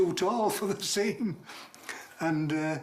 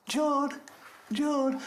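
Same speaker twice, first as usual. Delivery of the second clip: high, urgent name-calling; imitated call, pitched up; calling to rouse someone; 9 semitones higher than usual. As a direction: call the name to wake him. Mate trying to rouse collapsed man.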